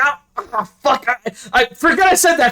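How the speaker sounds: high, fast backpedalling; flustered panic. Acted. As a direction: scramble to take it back.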